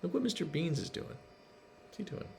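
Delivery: ordinary delivery, nothing marked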